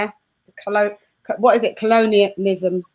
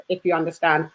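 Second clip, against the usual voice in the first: ordinary talk, nothing marked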